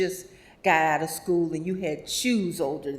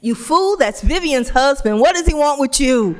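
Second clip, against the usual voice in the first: much louder, higher mock scolding; scolding shout; 7 semitones higher, 11 dB louder than usual. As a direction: bark at her friend. Sherry scolding Terry loudly.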